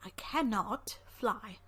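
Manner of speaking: very slow, stressing "cannot"; "I cannot fly", drawn out with a heavy "cannot"; slow deadpan. Acted. Doctor states slowly.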